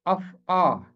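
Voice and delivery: अफ़आ falling on a long final "aa"; matching pronunciation. Shown as a demonstration. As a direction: set अफ़आ apart as af-aa, like aksaa. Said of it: "उसी तरह है".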